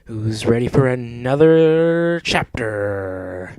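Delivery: loud, slow, stretched-out announcement; rousing call to the listeners; hyped intro. Pretend. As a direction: draw it out like a showman.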